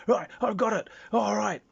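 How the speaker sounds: high, loud gotcha; triumphant grab. Acted. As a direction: crow it like you just caught a bug. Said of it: "He says".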